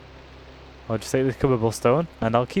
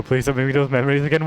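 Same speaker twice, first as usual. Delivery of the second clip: normal talking voice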